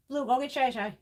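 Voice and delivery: usual speaking voice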